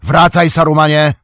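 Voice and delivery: loud, high command. A narrator's reading. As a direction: order him back sharply. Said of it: "tonem rozkazu". (głośny, władczy rozkaz)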